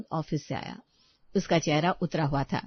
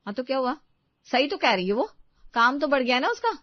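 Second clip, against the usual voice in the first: higher, louder, defensive retort; dismissive mother; 7 semitones higher, 5 dB louder than usual. Acted. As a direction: brush off the child's complaint. (टालते हुए पलटकर जवाब)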